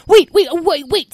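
high, loud, hurried stammer; panicked pleading. Acted. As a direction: beg attackers to hold off. Tom pleads before fairy attack.

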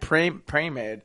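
"Pre-made" said slow and quiet, puzzled; confused repeat. Retold or imitated. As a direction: drawl out "Pre-, pre-made" slowly and softly. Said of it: "confused on the word he said".

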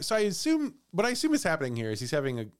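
usual speaking voice

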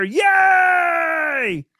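loud announcement rising into a high, drawn-out yay; excited cheer. Real, not acted. Host telling listeners the show is extended, excited cheer. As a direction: announce big news, then whoop with joy.

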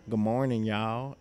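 lightly imitated Southern drawl; thick Southern accent. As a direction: greet with a touch of Southern accent. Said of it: "had a thick Southern accent".

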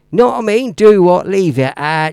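broad mock Southern accent, last words drawn out; Southern English accent. Imitating mate Vinny's parts, mock Southern English accent. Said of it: "I love the Southern accent".

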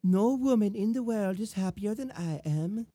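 put-on lightly girly love-letter reading in an accent; measured, accented mock-romantic woman; Belle. Woman writing to her suitor, mock-romantic accented voice. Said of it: "I'll read it in Belle's voice".

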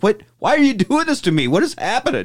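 high, panicked pleading; baffled kid being arrested. Retold or imitated. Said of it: "he's like".

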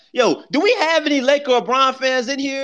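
high, loud call to the room. Real, not acted. Host calling Laker fans up to the stage, loud high call. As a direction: shout out to the whole room.

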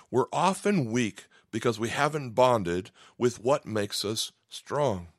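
slow down hard on makes us strong; slowed emphatic repeat. Shown as a demonstration. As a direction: let strong land slow and heavy. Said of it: "I'm gonna say that again".

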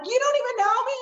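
high indignant retort. Retold or imitated. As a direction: snap back, affronted. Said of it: "I'm like".

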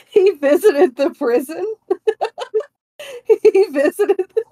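real giggles breaking through high words; can barely get the words out for laughing; laughing hard. Real, not acted. Co-host cracking up about Charles Dickens, laughing through it. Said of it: "Hold on, I'm crying".

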